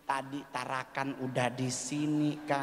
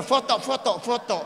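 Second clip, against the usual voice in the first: higher, louder eager call for photos; eager photo request; 7 semitones higher, 5 dB louder than usual. Retold or imitated. Comic calls to his manager for photos, high and loud. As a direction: call out to the manager to take pictures. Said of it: "langsung bilang sama manajer".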